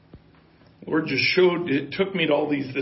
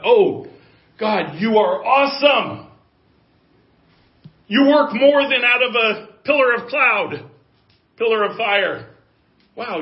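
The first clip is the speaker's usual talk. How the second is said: mock awed praise; 4 semitones higher, 5 dB louder than usual. People voiced praising God.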